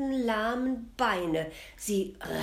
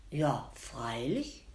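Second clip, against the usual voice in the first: put-on lower, slow old women's voice; 5 semitones lower, slower than usual. Old women eagerly agreeing to help the queen, slow and low. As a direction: answer like three old spinners, slowly.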